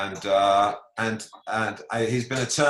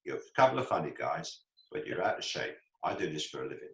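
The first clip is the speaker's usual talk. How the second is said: Michael Caine voice; 7 semitones lower, 9 dB quieter than usual. Retold or imitated. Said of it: "pulled his newspaper down and said".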